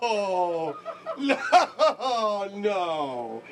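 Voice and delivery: high laughing protest. Real, not acted. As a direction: laugh it out in disbelief. Host laughing at caller.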